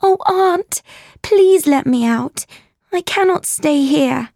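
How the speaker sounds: put-on high-pitched, desperate child's plea; anxious imploring; pleading. Child begs aunt for release.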